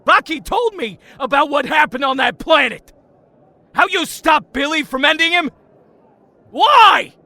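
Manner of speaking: rising, high-pitched bitter demand; a drunk friend's bitter outburst; angry accusation. Acted. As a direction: hurl the question high and hard. Drunk Tommy confronting Jason.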